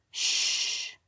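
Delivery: a sharp whispered hush. Acted. Prince hushing Kara in a whisper. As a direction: hush her before anyone hears.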